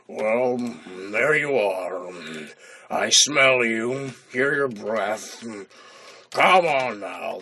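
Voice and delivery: low, very slow, drawn-out dragon impression. Dragon sniffing out the hidden intruder, slow dragon voice. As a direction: draw every word out deep and lazy. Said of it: "that's pretty much how he talks".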